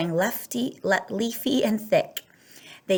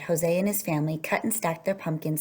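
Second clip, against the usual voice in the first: usual speaking voice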